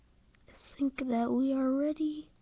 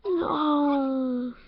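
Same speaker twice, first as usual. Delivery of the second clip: louder pained crying no; 4 semitones higher, 4 dB louder than usual. Real, not acted. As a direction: wail it at the sad scene. Dog still dying.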